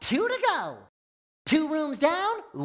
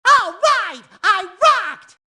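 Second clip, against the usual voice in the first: loud, high burst of pride; triumphant shout; 5 semitones higher, 8 dB louder than usual. Acted. Sponge who just rocked, triumphant shout. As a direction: celebrate a great score. Celebrating a good score.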